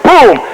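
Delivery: storyteller's exploding shot; imitated gunshot. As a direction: punch out a sudden boom. Imitates shot hitting horse.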